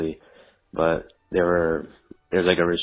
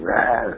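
short playful dinosaur roar; 4 semitones higher than usual. Pretend. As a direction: let out a quick mock dino roar. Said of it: "I forgot to do a dinosaur sound".

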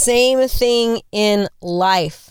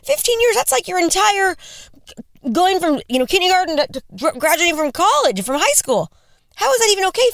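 hearing that her friend will wait fifteen more years, stunned high disbelief; shocked disbelief; 10 semitones higher than usual. Retold or imitated. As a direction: squeal it out like you can't believe your ears. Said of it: "I was like".

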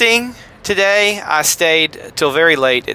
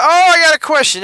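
excited; 12 semitones higher, 3 dB louder than usual. Retold or imitated. Retelling excitement over an email.

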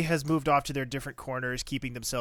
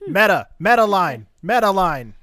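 loud, high, laughing call-out of a meta line; amused exclaim; 7 semitones higher, 13 dB louder than usual. Real, not acted. Host delighted by a meta line, loud laughing exclaim. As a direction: crow it out, delighted by the joke.

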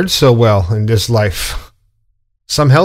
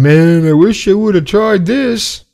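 mock deathbed regret; 5 semitones higher than usual. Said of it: "on your deathbed and going".

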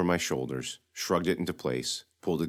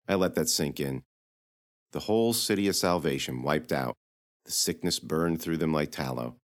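ordinary delivery, nothing marked

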